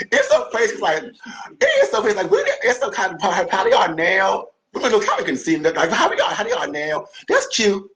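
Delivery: giggling through the words, pitched high; laughing. Real, not acted. Remembering old ballroom rivalries with a friend, laughing. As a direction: laugh it out while reminiscing.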